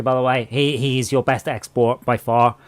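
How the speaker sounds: ordinary delivery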